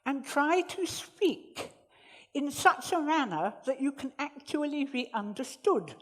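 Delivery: talking around a cork; cork in mouth. Shown as a demonstration. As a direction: speak through a cork. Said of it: "Stick the cork in your mouth".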